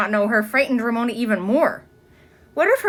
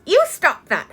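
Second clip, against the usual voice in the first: put-on prim girl; 7 semitones higher than usual. A narrator's reading. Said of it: "said Susan".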